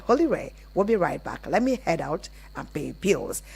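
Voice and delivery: ordinary talk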